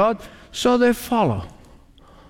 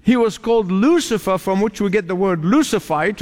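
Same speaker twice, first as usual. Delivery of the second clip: ordinary talk, nothing marked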